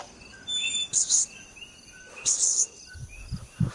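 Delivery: unvoiced psst sounds to call animals. Real, not acted. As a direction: hiss softly to get animals' attention. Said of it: "calling all my animals".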